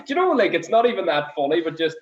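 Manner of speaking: ordinary delivery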